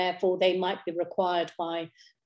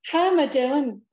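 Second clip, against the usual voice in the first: higher, louder, lilting Irish accent; slight Irish accent; 7 semitones higher, 6 dB louder than usual. Shown as a demonstration. Guest echoing a greeting heard on childhood holidays, slight Irish accent. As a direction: put on a touch of Irish accent. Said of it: "in a slightly Irish accent".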